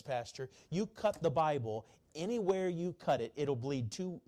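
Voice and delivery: ordinary delivery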